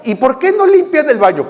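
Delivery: high-pitched mock complaint; voiced complainer. Preacher imitates member complaining to pastor, high mock-indignant voice.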